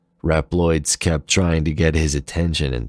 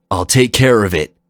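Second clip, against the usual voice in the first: louder, firm call as he rushes off; 5 semitones higher, 8 dB louder than usual. Acted. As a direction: call it out with resolve. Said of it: "Zero called".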